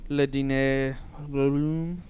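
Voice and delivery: slow, fake French accent; hammed-up French accent, slow; mock French. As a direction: drawl it like a snooty French waiter. Jokey fake French on dining room.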